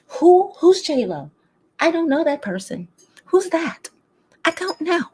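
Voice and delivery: high, feigned diva ignorance. Acted. Embodying a diva who pretends not to know people, high and dismissive. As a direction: play clueless about a rival, pitched high. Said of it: "[name] don't give a fuck about nobody".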